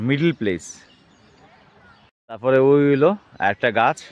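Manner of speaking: ordinary talk, nothing marked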